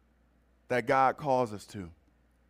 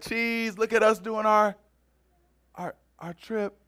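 mock tourist selfie; 7 semitones higher than usual.

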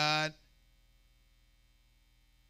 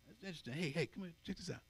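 hushed beckoning; 14 dB quieter, faster than usual. Acted. Listener drawn in quietly.

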